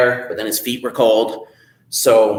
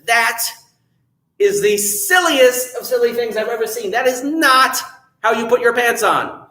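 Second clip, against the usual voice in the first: Jack's high exclaim; 10 semitones higher than usual. Acted. Jack scolding the man.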